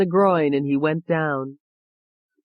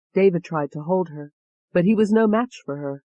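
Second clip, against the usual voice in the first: ordinary delivery, nothing marked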